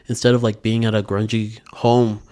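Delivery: normal talking voice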